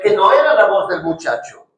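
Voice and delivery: normal talking voice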